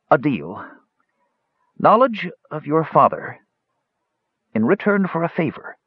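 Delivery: lightly voiced ailing mage, high; labored old mage. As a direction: offer it with effort. Mage struggles to offer a bargain.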